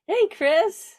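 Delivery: high, loud, excited hello; delighted greeting. Real, not acted. Host calls out to the guest who just joined, excited. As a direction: call out a hello, high and rising.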